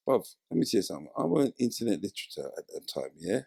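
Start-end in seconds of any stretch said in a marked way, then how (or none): none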